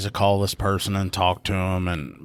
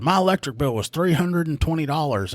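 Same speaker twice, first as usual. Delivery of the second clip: higher, whiny complaining woman; mock caller voice; 8 semitones higher than usual. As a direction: play the indignant caller. Voicing the drunk caller.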